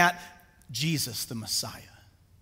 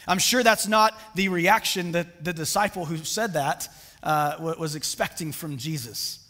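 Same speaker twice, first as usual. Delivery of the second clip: normal talking voice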